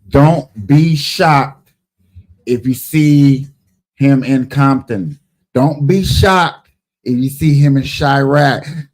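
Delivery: slow, loud, punched-out warning; emphatic warning. Real, not acted. Host warns listeners what they might see, slow emphatic warning. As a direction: spell it out slowly and forcefully, phrase by phrase.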